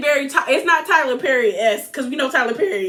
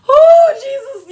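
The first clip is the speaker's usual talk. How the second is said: high, loud, slow laughing cry; cracking up; 11 semitones higher, 8 dB louder, slower than usual. Real, not acted. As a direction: howl it out between laughs. Cracking up at Erica's drama.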